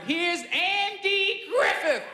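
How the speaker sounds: high, slow, drawn-out announcer call; MC introduction. Retold or imitated. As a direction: imitate a host stretching out an act's name. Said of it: "M C came out".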